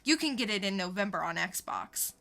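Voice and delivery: ordinary talk, nothing marked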